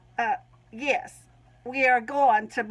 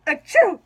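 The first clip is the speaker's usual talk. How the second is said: sudden loud burp that cuts the sentence; a burp jumps out; 6 semitones higher, 7 dB louder than usual. Real, not acted. Woman burping mid-testimony about her path, loud burp. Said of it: "these burps that came on".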